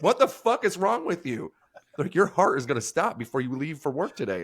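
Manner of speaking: high-pitched mock outrage; incredulous scolding, acted out; appalled disbelief. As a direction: imitate appalled coworkers, pitch jumping up. Alarmed coworkers scolding him, retold.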